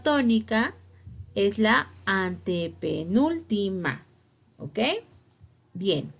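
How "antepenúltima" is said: "antepenúltima" slowly, in separate syllables. Shown as a demonstration. Teacher restating the rule, "antepenúltima" syllable by syllable. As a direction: space out every syllable of "antepenúltima". Key term spelled out by syllables.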